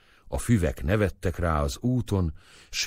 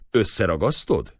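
ordinary delivery, nothing marked

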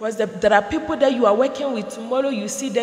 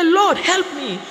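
fervent prayer; 5 semitones higher than usual. Acted. Preacher leading the congregation in prayer, fervent cry. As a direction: cry out to God for help, pleading.

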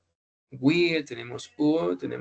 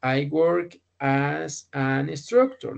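very slow, word by word; teacher dictating a phrase slowly; slow model phrase; much slower than usual. Shown as a demonstration. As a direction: drag each word out for learners. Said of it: "Le podría decir yo".

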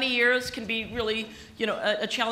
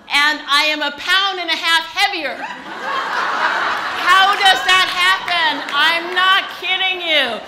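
loud, laughing outrage, higher pitch; outraged disbelief; 8 semitones higher, 12 dB louder, slower than usual. Real, not acted. Comic telling her scale gained weight, in laughing outrage. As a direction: shout it through laughter at the scale.

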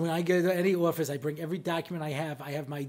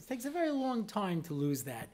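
normal talking voice

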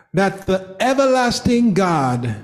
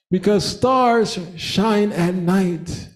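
ordinary delivery

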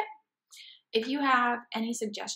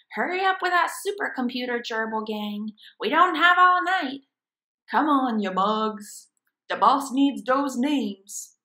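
louder, pushy orders to the gang; bossy rodent thug; 3 semitones higher, 7 dB louder than usual. Acted. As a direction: hurry the gang along roughly. Thug boss barking orders.

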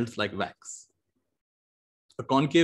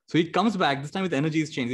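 ordinary delivery

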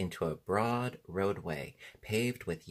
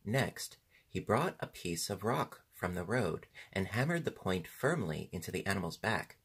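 ordinary delivery

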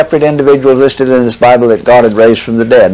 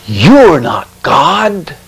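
retold exclamation; 3 semitones higher, much slower than usual. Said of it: "With that exclamation".

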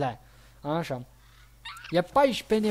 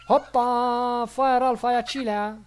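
high, slow, drawn-out hail; raised, showy exclamation; excited announcement; 5 semitones higher, much slower than usual. Real, not acted. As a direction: announce the arrival, stretching the words. Hails Fire Alpha's return.

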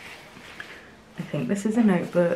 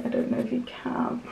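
whisper; 3 semitones higher, 8 dB quieter than usual. Real, not acted. Showing notebook inside to camera.

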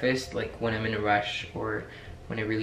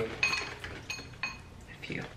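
whispered aside; 11 dB quieter than usual. Real, not acted. Muttered aside.

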